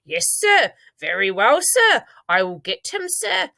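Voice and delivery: high-pitched, deferential sirs; obey nervously, lifting the first and last words; gulping obedience. A narrator's reading. Father agrees to fetch his son, nervously obedient.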